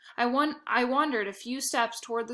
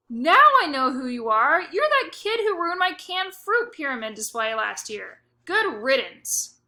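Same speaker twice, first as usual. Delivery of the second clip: much louder shouting, good riddance slowed way down; shout after; 5 semitones higher, 7 dB louder than usual. Acted. Grocer shouting after the departing bag boy, loud and angry. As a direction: bellow after someone walking off. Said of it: "Mister Snood shouted after me".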